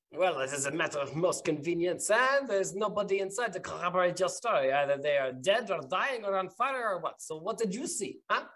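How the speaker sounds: unfriendly captain. Acted. Captain pressing witnesses about the fire, needling interrogation. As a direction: press the witnesses for answers in character.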